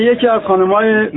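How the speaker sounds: ordinary talk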